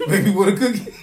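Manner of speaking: laughing mock-sweet offer to the kids; teasing through laughter. Teasing the kids with a cookie, laughing through it. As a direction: giggle out the offer like a tease.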